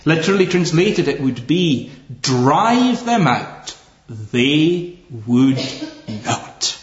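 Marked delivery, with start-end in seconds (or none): 2.2-6.8 slow, each phrase hammered, not fading; emphatic. Shown as a demonstration. Preacher gives the emphatic literal translation. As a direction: drive them out, slowly, then would not. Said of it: "it becomes very emphatic".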